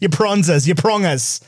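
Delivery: lightly imitated Australian slang; playful Australian twang; Australian accent. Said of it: "another Australian person responded and was like".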